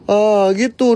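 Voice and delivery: ordinary talk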